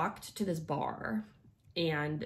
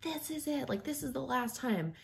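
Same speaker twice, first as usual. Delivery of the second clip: inner scream; 8 semitones higher than usual. Retold or imitated. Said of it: "in my mind, I was like".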